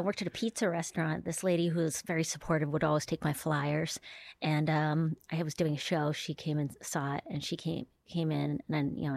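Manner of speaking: ordinary talk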